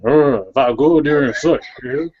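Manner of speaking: ordinary talk, nothing marked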